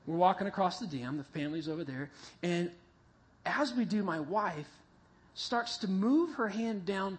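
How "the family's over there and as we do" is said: ordinary talk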